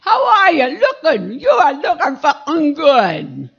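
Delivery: loud brash compliment shouted across the street; street catcall. Retold or imitated. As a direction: call out like a rough mill-town guy. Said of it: "someone say".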